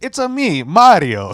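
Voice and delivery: high cartoon Italian accent, breaking into a laugh; Mario voice. Coworker quoting Mario catchphrase.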